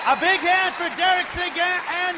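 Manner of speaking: loud, high game show announcement; announcer shout. Real, not acted. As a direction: announce the winner with full voice. Host announces winner.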